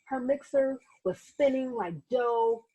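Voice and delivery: put-on gentle read-aloud narration for children; teacher reading a picture book aloud; story narration. A narrator's reading. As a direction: tell it like a bedtime storybook.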